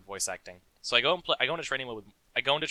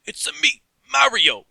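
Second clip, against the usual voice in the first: loud, much higher hammed-up Mario shout; Mario impression; 12 dB louder than usual. Showing a friend the old Mario cartoon voice, loud hammed-up shout. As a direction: belt it out like the cartoon plumber. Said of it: "he always sounds like this gruff Brooklyn man".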